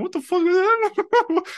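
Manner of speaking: put-on stunned kid losing it at the screen; shocked disbelief. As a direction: shriek it like your mind is blown. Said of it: "I'm like".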